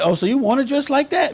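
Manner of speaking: high, mocking movie-line voice; Django character quote. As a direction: tease like the woman in the film. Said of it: "she said".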